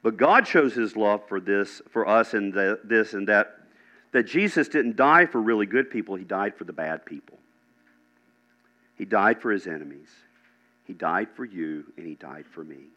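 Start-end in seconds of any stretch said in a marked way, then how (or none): 6.2-12.9 soft, low preaching with long pauses; hushed, solemn preaching. Real, not acted. Preacher telling the congregation Jesus died for them, hushed. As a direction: bring it down softly and let it sink in.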